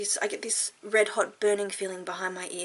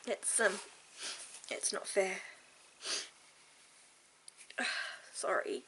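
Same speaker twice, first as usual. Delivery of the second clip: quiet tearful crying; 10 dB quieter than usual. Real, not acted. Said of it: "I hate crying".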